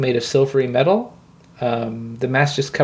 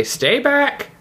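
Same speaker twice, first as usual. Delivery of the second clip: warning shout; 12 semitones higher than usual. Acted. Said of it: "you hear the voice say".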